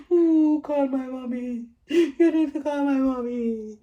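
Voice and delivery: high, loud, slow pleading wail; begging. Retold or imitated. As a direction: beg for your mother like a child. Exhausted in labor, begging.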